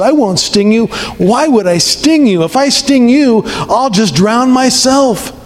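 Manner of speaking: high-pitched, insistent storybook protest; protesting scorpion. Acted.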